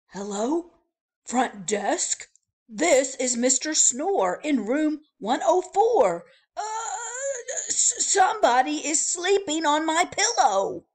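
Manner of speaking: loud, high, flustered phone call; flustered guest. Acted. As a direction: complain in a startled fluster. Phoning front desk alarmed.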